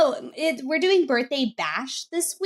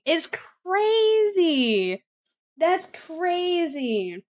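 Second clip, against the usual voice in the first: drawn out; 5 semitones higher, much slower than usual. Real, not acted. Stunned reaction to the clip.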